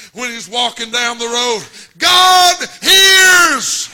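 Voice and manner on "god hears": loud high preaching shout; crying out. Acted. Father calling down the road, shouting high and loud. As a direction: cry it out like a desperate father. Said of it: "what he's really saying".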